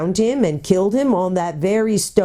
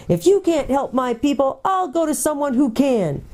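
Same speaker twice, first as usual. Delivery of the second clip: high-pitched angry cry, held just short of full volume; screaming; 10 semitones higher than usual. A narrator's reading. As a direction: push the voice up in heated defiance. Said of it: "whose voice now rose to a scream".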